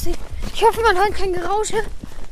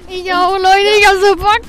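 excited; 3 semitones higher, 12 dB louder than usual. Real, not acted. Hyped up for the prank.

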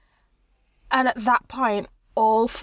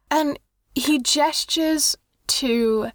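ordinary talk, nothing marked

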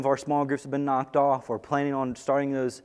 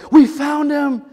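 much higher and louder, jubilant; enthusiastic shout; 12 semitones higher, 8 dB louder than usual. Acted. First disciples announcing they found the Messiah, jubilant shouting. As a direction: shout it out like the best news ever. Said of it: "That's the enthusiasm here".

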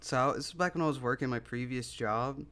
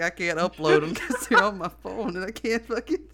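loud, high excuse cracking into laughter; retold dog owner; 7 semitones higher, 7 dB louder than usual. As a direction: imitate his flustered excuse while laughing. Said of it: "He's like".

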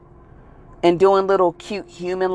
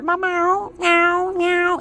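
string of high, level meows; cat meow imitation; 10 semitones higher, much slower than usual. Kitty boasting of its new home, meowing away.

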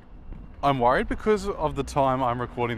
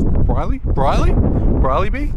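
louder, rising with each try of Briley; excited; 6 semitones higher, 8 dB louder, slower than usual. Real, not acted. Loving a player name, excitedly trying it out. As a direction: savour the name, getting more excited. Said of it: "I like this".

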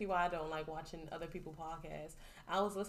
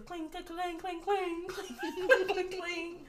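clinking sound imitation; 10 semitones higher, 4 dB louder, much slower than usual.

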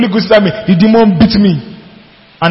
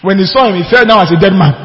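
ordinary delivery

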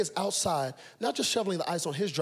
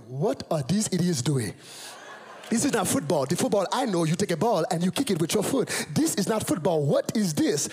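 put-on baffled complaint in a Nigerian accent; Nigerian father. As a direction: imitate an indignant Nigerian dad watching football. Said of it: "staring at the screen going".

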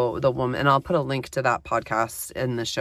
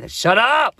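higher, louder playful shout; mock shout; 8 semitones higher, 6 dB louder than usual. Telling her inner voice to stop, a mock shout. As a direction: snap it out at the nagging voice, half-joking.

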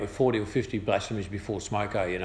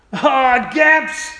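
high loud yell of the name, hammed up; mock cursing shout; 13 semitones higher, 10 dB louder than usual. Railway worker yelling a mate's name at the hammer, hammed-up shout. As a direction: bellow it like you just smashed your thumb.

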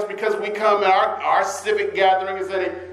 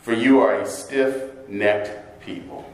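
scripture reading; 3 semitones lower than usual. Acted. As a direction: read the scripture with weight. Preacher reads scripture aloud.

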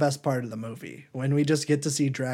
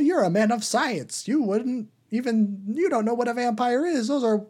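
mock skeptic voice; 10 semitones higher, 4 dB louder than usual. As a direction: play the know-it-all who laughs off vampires. Skeptic character mocks vampire belief.

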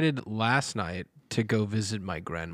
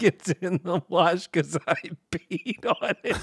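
quoting grandma while laughing; imitating grandma laughing as she says it; laughing through it; 6 semitones higher than usual. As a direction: giggle through the punchline. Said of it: "she cries saying it".

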